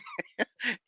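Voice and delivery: high breathy giggle. Real, not acted. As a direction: chuckle at the memory. Laughing at memory.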